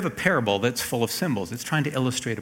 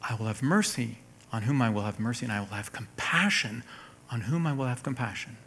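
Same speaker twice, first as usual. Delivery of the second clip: gentle, softer divine promise; completely different emphasis; 5 dB quieter than usual. Acted. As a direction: read it quietly, as reassurance. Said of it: "The emphasis in Romans nine is completely different".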